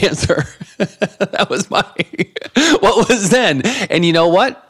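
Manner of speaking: words breaking up into high laughter; cracking up mid-sentence; real laughter. Real, not acted. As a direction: laugh helplessly through the line. Laughs while telling his own story.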